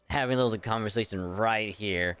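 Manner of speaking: usual speaking voice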